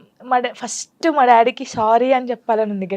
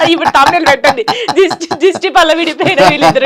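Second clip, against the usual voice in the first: louder, talking through laughter; laughing joke; 5 semitones higher, 9 dB louder than usual. Real, not acted. Joking to the interviewer about the thumbnail, laughing. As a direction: laugh while pitching the thumbnail.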